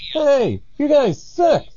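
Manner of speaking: high, drawn-out mock taunt; distant taunting caller. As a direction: call it over a sand dune, high and slow. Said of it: "they hear".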